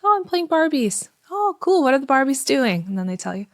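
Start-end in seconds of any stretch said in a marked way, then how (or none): 0.0-1.1 lightly voiced little girl, higher; a hint of a small child answering; child's voice. Parent mimicking the child's reply about her Barbies. 1.3-2.8 higher, bright-eyed interest in the game; enthusiastic parent. Shown as a demonstration. Parent sitting by the child, excited about the Barbies. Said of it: "and say".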